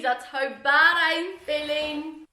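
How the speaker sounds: slow, drawn-out mock confession; mock dramatic. Real, not acted. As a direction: ham up the guilty admission. Jokey confession about drinking Coke.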